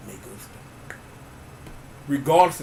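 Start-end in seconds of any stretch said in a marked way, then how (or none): 0.1-1.0 whisper. Pretend. Whispered aside.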